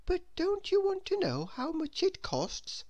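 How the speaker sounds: a polite nudge from the saleslady; lightly voiced saleslady. Saleslady asks.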